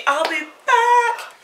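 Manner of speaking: high, slow, drawn-out I'll be back; Terminator line. Pretend. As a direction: stretch it like a movie catchphrase. Repeated promise, drawn out.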